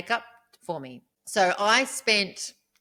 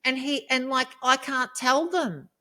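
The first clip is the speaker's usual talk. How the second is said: real upset venting; 7 semitones higher, 4 dB louder than usual. Real, not acted. Woman upset she can't warn the other women, impassioned. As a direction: let the anguish climb, stretching the key words.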